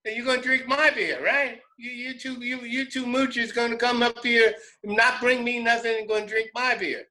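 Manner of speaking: high hillbilly scolding; high, aggrieved country rant; mock yelling. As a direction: chew out the moochers, hammed up. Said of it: "he starts yelling at him".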